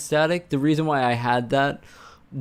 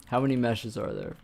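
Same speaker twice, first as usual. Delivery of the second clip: usual speaking voice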